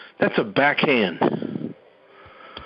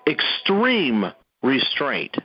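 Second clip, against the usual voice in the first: extreme restraint drawn out at half speed; emphatic stress; much slower than usual. Real, not acted. Speaker urging the militia, slow emphatic extreme restraint. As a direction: stretch out extreme, then land restraint separately. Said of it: "cannot emphasize that word enough".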